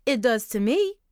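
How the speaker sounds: insisting. Acted. Mother insisting to her daughter, firm and loud. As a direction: press the point, voice rising. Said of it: "Mom insisted".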